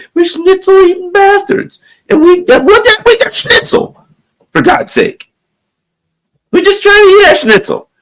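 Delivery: very high squeaky cartoon German voice; mocking German patriots in a high squeaky voice. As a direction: ham up a German guy fretting over his schnitzel. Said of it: "German people that are like".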